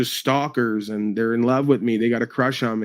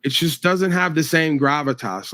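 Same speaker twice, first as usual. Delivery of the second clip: ordinary talk, nothing marked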